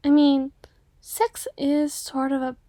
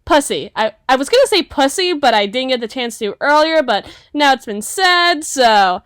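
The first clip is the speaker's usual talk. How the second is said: louder, cheeky crude punchline; loud blurted joke; 9 dB louder than usual. Acted. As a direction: proudly get the joke out at last.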